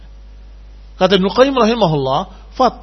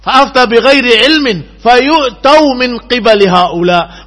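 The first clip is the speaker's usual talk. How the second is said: loud, slow, higher Arabic recitation; hadith recitation; 7 semitones higher, 11 dB louder, slower than usual. Acted. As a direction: declaim the hadith slowly and forcefully. Quoting Arabic hadith in sermon. (lantunan hadis keras dan lambat)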